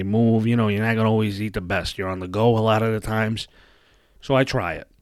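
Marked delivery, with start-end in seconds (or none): none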